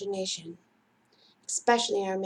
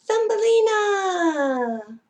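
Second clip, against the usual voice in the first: high, slow, delighted call of the name; drawn-out happy cry of the name; excited greeting; 9 semitones higher, 7 dB louder, much slower than usual. Acted. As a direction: greet her like a long-lost friend. Greeting imaginary friend excitedly.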